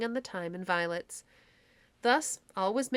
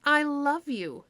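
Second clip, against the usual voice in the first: higher, slow, lovestruck; adoring fairy queen; 6 semitones higher, 5 dB louder, slower than usual. A narrator's reading. Titania to the donkey-headed clown, slow adoring declaration. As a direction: linger on each word, besotted.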